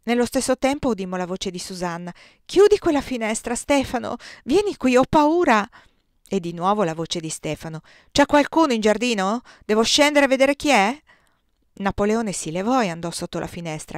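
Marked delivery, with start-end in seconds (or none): 2.5-5.7 loud, high, alarmed call; frightened pleading. A narrator's reading. Frightened wife calls Stefano inside. 8.1-11.0 loud, raised questioning call. A narrator's reading. Stefano calls down into dark garden.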